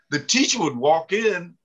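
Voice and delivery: usual speaking voice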